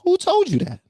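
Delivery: high-pitched disbelief; comically outraged question; incredulous challenge. Real, not acted. Mock disbelief at the quoted claim.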